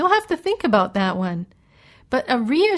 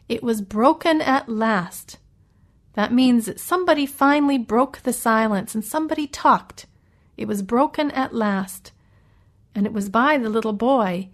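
ordinary talk

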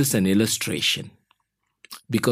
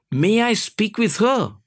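boss asking; 9 semitones higher than usual. A narrator's reading. Acted boss asking the child.

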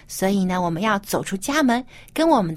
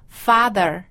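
ordinary delivery

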